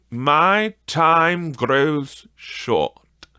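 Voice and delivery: slow, solemn spirit warning; solemn ghost. Acted. The Spirit warning Scrooge, slow and solemn. Ghost warns time is short.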